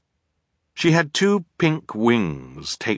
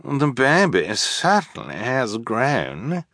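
high, slow, affected drawl; haughty villainess voice; 5 semitones higher, slower than usual. A narrator's reading. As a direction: look the child over in a high drawl. Villainess looks over the child.

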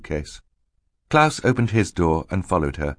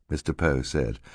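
plain reading voice

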